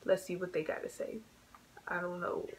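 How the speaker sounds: ordinary talk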